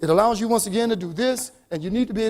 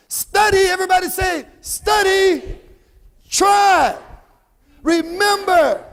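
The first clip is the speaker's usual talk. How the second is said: loud, high shouted call-outs; rallying cry, each word punched out; relentless enthusiasm; 7 semitones higher, 7 dB louder, slower than usual. Shown as a demonstration. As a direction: lead the chant with full-throated excitement. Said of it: "say it with that relentless enthusiasm".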